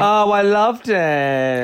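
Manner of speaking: slow, high, drawn-out gush. Real, not acted. Co-host defending the gold tinsel look, gushing. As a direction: stretch it out high with delight.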